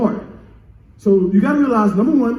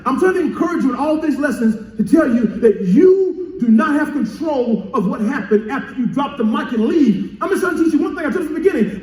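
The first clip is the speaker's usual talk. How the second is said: higher, driving preacher's delivery; impassioned preaching; 5 semitones higher than usual. Real, not acted. Pastor encouraging the congregation about harvest, impassioned preaching. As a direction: press the lesson home with rising pitch.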